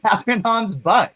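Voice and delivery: mock all-caps shout. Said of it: "Emma in chat in all caps".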